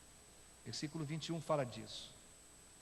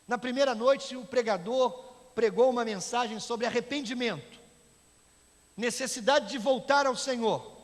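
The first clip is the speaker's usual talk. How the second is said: loud, high, forceful pulpit delivery; preaching at full force; 9 semitones higher, 13 dB louder than usual. Acted. Preacher telling the congregation of revival night, full-force preaching. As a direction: project the story to the whole church.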